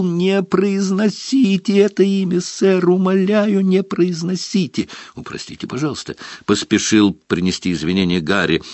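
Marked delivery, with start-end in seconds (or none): 0.0-4.9 high, pleading elf howl. Acted. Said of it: "взвыл". 5.1-6.2 hushed apology. Acted. Harry hurried apology.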